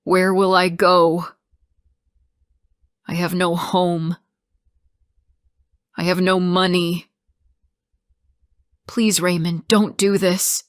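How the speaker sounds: insistent, distressed appeal; desperate plea. A narrator's reading. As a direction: plead with nowhere left to go. Narrator voices a desperate plea.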